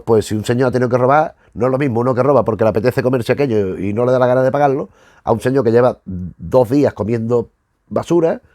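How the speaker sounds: ordinary talk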